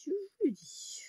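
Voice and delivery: high, airy echo in a whisper; hushed last repeat, all breath; playful whisper. Pretend. Playful repetition fading to whisper.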